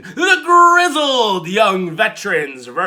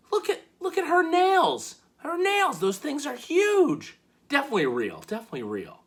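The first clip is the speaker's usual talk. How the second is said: hammed-up swooning over the nails; mock gushing; 10 semitones higher, 5 dB quieter than usual. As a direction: gush like a starstruck fan. [name] mocks Theory's staring.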